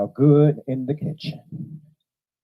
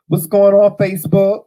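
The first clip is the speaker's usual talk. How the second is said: loud, high hail to the audience; calling out; 7 semitones higher, 5 dB louder than usual. Real, not acted. Host hailing Facebook viewers as the stream starts, calling out loudly. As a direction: holler a big high greeting.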